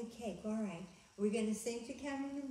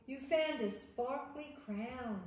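ordinary delivery, nothing marked

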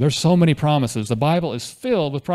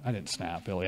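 usual speaking voice

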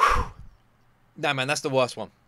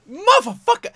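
high, loud cursing yelp; frustrated yelp; 5 dB louder than usual. Real, not acted. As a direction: spit the curse out high. Frustrated reaction outburst.

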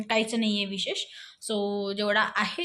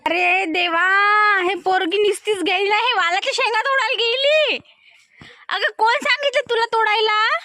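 surprised scolding; 9 semitones higher, 4 dB louder than usual. Real, not acted. Mother sees toddler picking beans.

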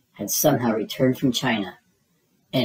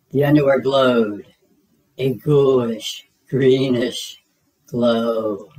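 spooky reveal; 4 dB louder, slower than usual. A narrator's reading.

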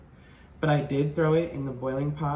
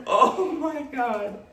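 amazed; 11 semitones higher, 5 dB louder, slower than usual. Real, not acted. Seeing the huge claw.

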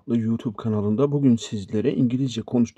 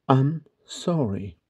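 "I'm sorry" drawn out slowly; slow teaching repetition; 2 semitones higher, much slower than usual. Shown as a demonstration. Teacher repeats phrase slowly for learners. ("I'm sorry" yavaş yavaş, tane tane)

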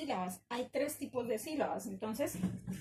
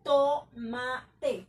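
"Tomate" loud, split into to-ma-te; syllable by syllable; 7 dB louder than usual. Shown as a demonstration. Teacher breaking down an example word for students, syllable by syllable. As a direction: beat out each syllable of "Tomate" separately. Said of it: "vamos a mirar la palabra tomate".

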